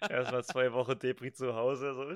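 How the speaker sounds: usual speaking voice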